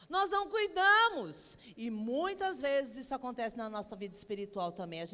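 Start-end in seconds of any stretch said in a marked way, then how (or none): 0.1-1.6 louder, higher and slow, each word hammered; slow, pounding sermon emphasis; emphatic preaching. Real, not acted. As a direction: land the rebuke hard on the congregation. Preacher drives home the point.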